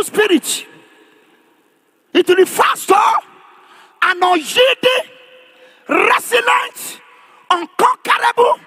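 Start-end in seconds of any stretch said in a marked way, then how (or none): none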